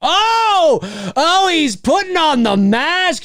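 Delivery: high, thrilled gasp of delight; fanboy excitement; excited. Real, not acted. Thrilled seeing the mask scene.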